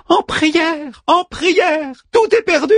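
high-pitched stage despair; reciting in high voice. A narrator's reading. Manmut recites the doomed sailors, high theatrical cry. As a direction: cry the repeated words like an actor. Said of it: "récita Manmut".

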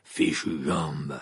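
low, very slow grumble; furious muttering. A narrator's reading. Moody curses his bad leg, furious muttering. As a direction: grumble low at your own body. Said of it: "marmonna-t-il avec fureur".

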